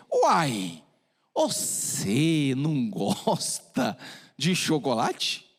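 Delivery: put-on slow, puzzled "Uai" in a Minas accent; a mineiro doctor asking, surprised. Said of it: "o médico era mineiro". (médico mineiro intrigado)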